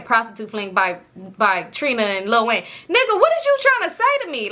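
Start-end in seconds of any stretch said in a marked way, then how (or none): 2.6-4.5 high, disbelieving cry; high indignant what-are-you-saying; incredulous outburst. Real, not acted. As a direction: pitch way up in disbelief. Incredulous at his post.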